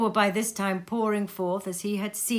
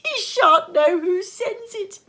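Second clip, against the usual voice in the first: much higher, louder, slow, laughing through the words; splitting with laughter; 10 dB louder, slower than usual. A narrator's reading. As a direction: cackle with glee as you speak. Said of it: "splitting with a laugh".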